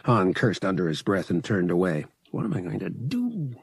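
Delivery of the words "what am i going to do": softer, worried question to himself; wondering aloud. Acted. Han alone, out of time, wondering quietly. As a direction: ask it under your breath. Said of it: "he wondered".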